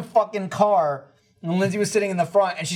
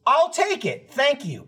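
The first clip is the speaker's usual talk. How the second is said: eager high accept; 7 semitones higher, slower than usual. Retold or imitated. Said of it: "And I went".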